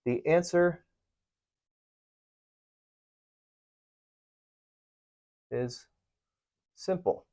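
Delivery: word-by-word with long pauses; dictating slowly. Shown as a demonstration. Teacher writing the answer on the board, slow. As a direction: spell the words out as you write. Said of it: "You see how you're answering".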